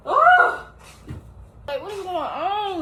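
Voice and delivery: loud high startled cry. Real, not acted. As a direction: jump at the thunder. Thunder starts suddenly.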